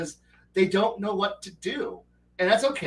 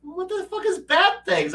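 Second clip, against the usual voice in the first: incredulous outburst; 11 semitones higher than usual. Retold or imitated. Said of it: "And they're like".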